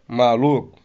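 ordinary talk, nothing marked

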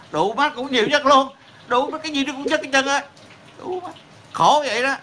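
real exasperation, voice cracking up high; exasperated outburst. Real, not acted. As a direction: pitch jumps high, cursing in frustration. Ranting about America being number one.

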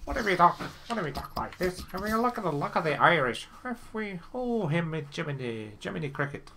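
hammed-up Irish accent with big pitch swoops; bad Irish accent. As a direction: put on a badly faked Irish accent. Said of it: "offended by my Irish accent, which is really bad".

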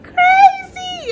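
high, slow squealing praise; real thrilled squeal over the gift; excited squeal. Real, not acted. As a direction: stretch it into a delighted squeal. Raving about sister's gift.

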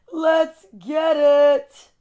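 "Let's get it" stretched out very slow and pumped up; slow, hyped-up rallying cry on "Let's get it"; hype call. Shown as a demonstration.

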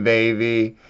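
ordinary talk, nothing marked

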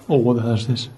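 ordinary talk

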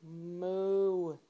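long, soft-edged moo; playful cow imitation. Cow noise over picture book.

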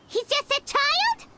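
high, disbelieving question; surprised. Acted. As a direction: raise it in disbelief. Surprised at ghost's age.

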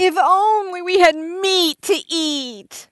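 high, slow, drawn-out whine; whiny voice. Acted. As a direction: whine it like a spoiled child. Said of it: "You have to read it with a whiny voice".

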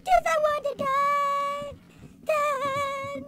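high, slow drawn-out whine; mock wail. Bored player wanting to die, high mock wail. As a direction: wail it in hammed-up despair.

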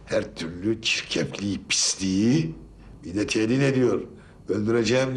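thin phone-line sound; talking down a telephone line; over the phone. Real, not acted. Recorded phone statement, far timbre.

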